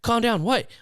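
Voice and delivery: hammed-up high calm-down; mock startled reply. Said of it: "and I'm like".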